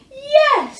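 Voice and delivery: booming teacher dragon; Madam Dragon. A narrator's reading. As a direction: trumpet it out.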